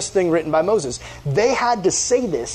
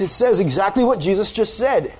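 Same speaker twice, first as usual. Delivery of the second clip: usual speaking voice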